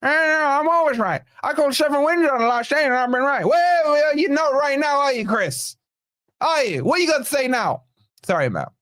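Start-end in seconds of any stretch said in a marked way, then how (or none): 0.0-3.4 much higher, smug mocking voice; mock Chris impression. Streamer mimics Chris bragging about his predictions, smug and high. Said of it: "Amazing impression". 3.4-7.8 gloating taunt. Real, not acted. Taunting Chris in chat.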